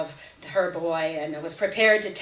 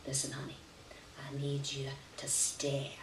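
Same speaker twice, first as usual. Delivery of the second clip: put-on much softer, close and hushed to the child; soft coaxing; 4 semitones lower, 13 dB quieter than usual. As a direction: draw the little girl in quietly. Aunt coaxing little Lena close.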